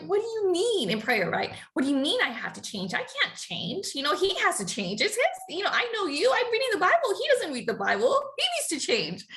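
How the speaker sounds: high, indignant protest. Retold or imitated. Arguing with God that husband must change, high and indignant. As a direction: argue back, high and offended. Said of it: "I'm like".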